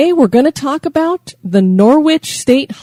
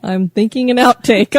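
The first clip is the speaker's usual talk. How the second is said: normal talking voice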